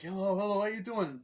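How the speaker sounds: high-pitched called hello; mock cheery greeting. Racers greeting each other, high mock-cheery call.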